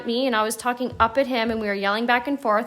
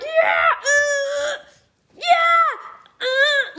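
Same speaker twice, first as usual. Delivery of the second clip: high, loud whooping cheer; high excited shouts of encouragement; 14 semitones higher, 4 dB louder, much slower than usual. Retold or imitated. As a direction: cheer your teammate on from the hospital bed. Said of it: "cheering her on from my birthing room".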